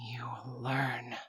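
slow breathy whisper, almost no voice; hushed slow whisper of a promise. Acted. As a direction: lean in and breathe the words. Repeats line as slow whisper.

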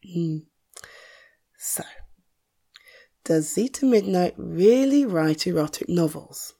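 ordinary delivery

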